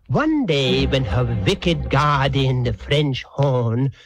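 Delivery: ordinary talk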